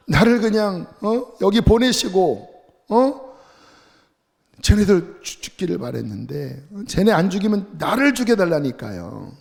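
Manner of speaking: hammed-up prophet yelling at God to kill him; mock tantrum. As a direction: throw a childish fit at God.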